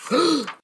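high hammed-up gasp; mock gasp. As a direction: gasp like you are shocked.

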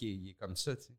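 normal talking voice

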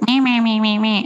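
mock your own chirpy little babble; self-mocking "meh meh". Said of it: "where I'm like".

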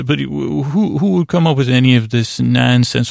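usual speaking voice

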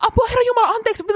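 very high, panicky apology; flustered blurting. Retold or imitated. Blurting at a man she nearly bumped into, flustered. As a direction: reenact a startled high-pitched outburst. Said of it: "mun suu on silleen".

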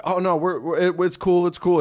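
mock guilty dude insisting it's cool; caught thief brushing it off. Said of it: "And the guy's like".

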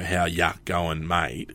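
slow, stretched-out "How ya going, mate?"; drawn-out slang greeting. Shown as a demonstration. Podcaster showing the slang he resisted, slow and drawn out. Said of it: "resisted the urge to kinda go".

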